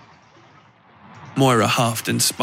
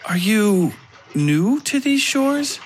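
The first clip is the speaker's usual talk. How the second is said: put-on slow, much higher, lilting young woman; young lady Moira; 10 semitones higher, much slower than usual. A narrator's reading. Moira greeting a stranger at the port, slow and high. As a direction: drawl it out sweetly, high and slow.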